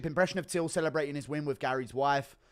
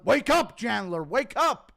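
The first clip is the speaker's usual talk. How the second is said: much higher, loud yell to wake him; wake-up shout; 8 semitones higher, 9 dB louder, slower than usual. Acted. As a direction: shout it at a sleeping man. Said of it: "Impression of Drew Dober, Factory, Reset, and Chandler".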